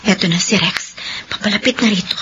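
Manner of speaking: whisper. Acted. Sister sees brother approaching.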